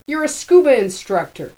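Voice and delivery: high, loud contemptuous jab; scornful. A narrator's reading. Jean mocking Hammy.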